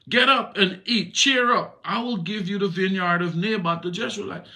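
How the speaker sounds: Jezebel's voice. Acted. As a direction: order him up and promise the vineyard.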